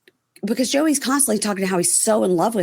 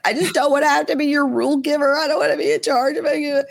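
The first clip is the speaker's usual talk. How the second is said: high-pitched mock-weepy whine; hammed-up tearful complaint; imitating crying; 9 semitones higher than usual. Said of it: "[name] is crying".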